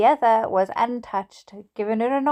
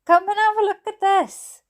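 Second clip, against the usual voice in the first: higher, bright-eyed call to come look; eager invitation, pitched up high; excited calling over; 9 semitones higher, 3 dB louder than usual. Acted. Dad calling child to the window, excited.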